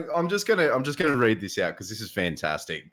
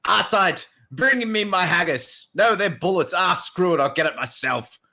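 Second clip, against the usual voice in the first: higher and louder, booming mock warrior; Shax voice; 9 semitones higher, 5 dB louder than usual. Streamer quotes Shax demanding haggis, hammed-up booming. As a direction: bellow it like a hungry war lord. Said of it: "the new Mayhem lines".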